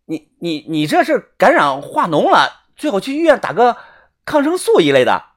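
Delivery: stammering through the nausea; holding back the urge to retch; queasy. Acted. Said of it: "我强忍着恶心啊".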